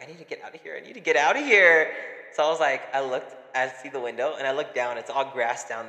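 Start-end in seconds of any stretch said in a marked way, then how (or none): 0.0-0.8 softer, muttered to herself; quiet panic. Retold or imitated. Trapped, quoting panicked self. 0.8-1.9 louder urgent repeat; the panic boils over into a louder cry. Retold or imitated. Same words, now louder.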